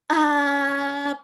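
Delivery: slow ah with a pop at the start; glottal pop ah. Shown as a demonstration. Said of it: "popping sound".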